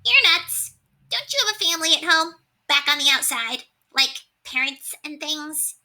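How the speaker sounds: high, squeaky rat, incredulous; a small rat scoffing in disbelief; high-pitched rat scolding his friend. A narrator's reading. Rat character voice.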